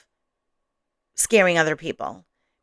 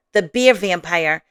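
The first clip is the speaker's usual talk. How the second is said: usual speaking voice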